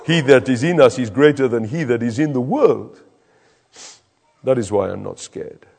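put-on lightly done West African accent; a hint of a West African friend. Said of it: "with a big, broad West African accent".

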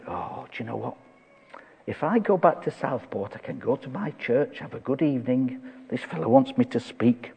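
softly thinking aloud, reluctant; quiet inner musing. Retold or imitated. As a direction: drop your voice as if privately weighing it up. Voicing his own reluctant thoughts.

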